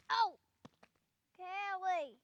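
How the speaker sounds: sudden cry, then pained groan; tripping and falling. Real, not acted. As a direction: call out while falling and hurting. Falls and hurts his back.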